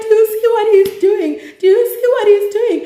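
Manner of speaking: high, loud frantic shout to the parents; outraged high yell. Retold or imitated. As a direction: shriek it out, pointing at him. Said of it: "I'm like".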